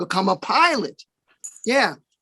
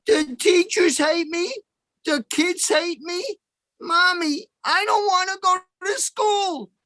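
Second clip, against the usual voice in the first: put-on whiny kid; 9 semitones higher than usual. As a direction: drag it out like a sulking little boy pleading. Whining kid begging his mother.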